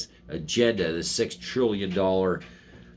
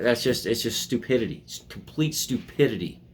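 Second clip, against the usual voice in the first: ordinary talk, nothing marked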